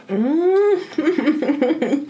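high savoring hum with mouth full; delighted mm while chewing. Real, not acted. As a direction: hum with pleasure over a bite. Tasting the chicken, delighted.

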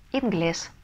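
ordinary talk, nothing marked

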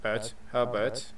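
ordinary talk, nothing marked

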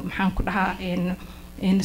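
choked up, voice fading away; breaking off, fighting back tears; emotional. Real, not acted. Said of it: "I'm so emotional".

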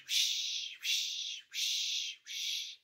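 whispered whoosh; brushing sound. Shown as a demonstration. Storyteller imitates painting strokes, breathy whisper. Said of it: "varnished and painted".

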